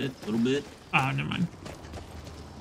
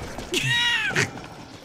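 sudden high-pitched squealing laugh; real laugh at being tricked. Real, not acted. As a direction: burst out in a high cackle.

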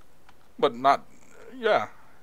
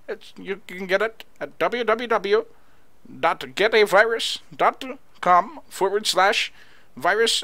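mock Indian accent. Fake Microsoft support caller talking victim through install, mock Indian accent. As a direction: pitch the scam in a hammed-up Indian accent. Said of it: "What do you say for an accent".

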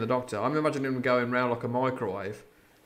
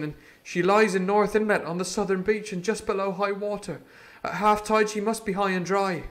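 put-on higher boyish voice, steady, resolute; Jim, resolute; 6 semitones higher, 6 dB louder than usual. Jim refuses the doctor's escape, higher boy voice. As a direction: stand firm like a brave lad. Jim's voice held.

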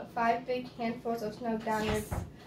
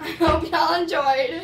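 laughing; 8 dB louder than usual. Real, not acted. Laughing outro.

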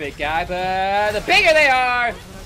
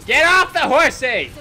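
high, loud playful order; shouted command; 4 semitones higher, 6 dB louder than usual. Real, not acted. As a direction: call it out forcefully. Repeats it as an order.

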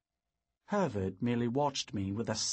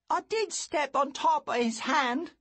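put-on high earnest boy; a boy owning up; 4 dB louder than usual. A narrator's reading. Boy Lou explains.

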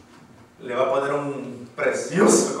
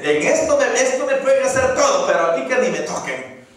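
defiant boast; 7 semitones higher, 4 dB louder than usual. Retold or imitated. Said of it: "Hay gente que dice".

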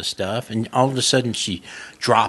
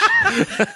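short, very high laugh; real high-pitched giggle burst; 3 dB louder than usual. Real, not acted. Laughing at the punchline.

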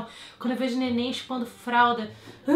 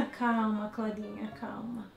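soothing; 5 dB quieter, slower than usual. Acted. Soothing the dog.

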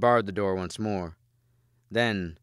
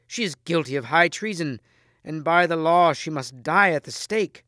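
high, loud condemnation; accusing; 8 semitones higher, 8 dB louder than usual. A narrator's reading. Mordred condemning the queen before Arthur, accusing. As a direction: pronounce her guilt forcefully.

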